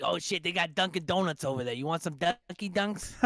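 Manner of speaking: put-on high, thick New England accent. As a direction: do your buddy's thick Boston accent. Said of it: "thick-ass New England accent".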